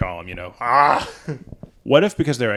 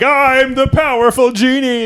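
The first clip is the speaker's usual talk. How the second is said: higher, louder, slow proclamation; mock grand genie; 12 semitones higher, 8 dB louder, slower than usual. Genie grandly introducing itself, loud and slow. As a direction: announce yourself like a pompous genie.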